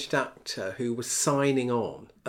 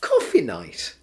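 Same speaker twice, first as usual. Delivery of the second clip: high, loud, laughing disbelief; amused incredulity; 8 semitones higher, 6 dB louder than usual. Real, not acted. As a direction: marvel at how absurd it is, pitch jumping up. Incredulous at own reason for skipping rehearsal.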